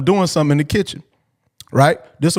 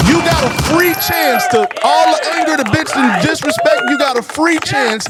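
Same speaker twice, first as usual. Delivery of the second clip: fired-up preaching; 5 semitones higher than usual. Real, not acted. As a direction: preach it hard to the guys. Fired-up advice to men.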